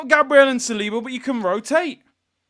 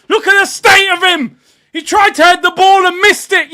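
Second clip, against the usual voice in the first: raised, scornful yelling, higher and louder; loud disgust at the player; angry mocking; 9 semitones higher, 10 dB louder than usual. Real, not acted. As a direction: tear into the defender. Fan mocking the defender.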